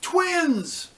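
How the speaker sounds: high, loud delighted cry of surprise; bright surprised burst; surprised exclaim. A narrator's reading. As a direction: exclaim it like a happy discovery. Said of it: "she exclaimed".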